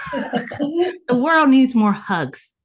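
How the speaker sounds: slow, laughing plea; laughing and drawn out. Real, not acted. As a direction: stretch it out through a laugh.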